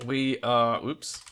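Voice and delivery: ordinary talk, nothing marked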